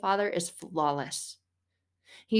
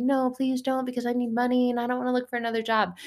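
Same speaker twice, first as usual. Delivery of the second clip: mock pleading; 5 semitones higher, faster than usual. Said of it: "But you're like".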